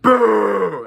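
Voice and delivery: loud, high boo; mock boo. Reviewer booing the movie's cheap resolution, loud high boo. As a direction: boo the film like a heckler. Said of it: "I'm like".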